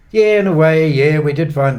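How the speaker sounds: high, loud Yeah flung out; loud eager burst. Acted. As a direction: burst in with it, full of energy. Nui bursts into his story.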